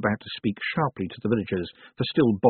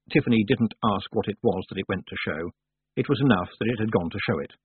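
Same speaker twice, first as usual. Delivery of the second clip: usual speaking voice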